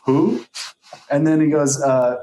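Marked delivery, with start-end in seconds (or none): 0.0-0.4 deadpan "Who?" as a retold quote; mock blank reply. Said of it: "Straight up goes".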